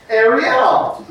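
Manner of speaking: calling Ariel across the room. Real, not acted. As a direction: call the name up high across the room. Calling out to someone.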